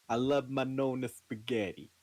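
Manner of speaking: put-on joking Brooklyn Italian accent. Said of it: "It's very Brooklyn".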